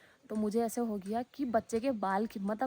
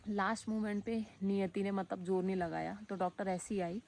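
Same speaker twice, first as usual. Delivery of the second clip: normal talking voice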